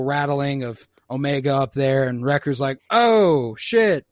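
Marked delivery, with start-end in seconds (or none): 2.9-4.1 high, loud drawn-out exclamation; mock dismay. Brute covering for rattling overhead, loud mock dismay. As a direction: exclaim it in exaggerated alarm.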